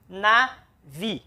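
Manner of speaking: NAVI with a clear pause between "na" and "vi"; split into syllables. Shown as a demonstration. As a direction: break navi into two separate syllables. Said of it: "Separa a sílaba".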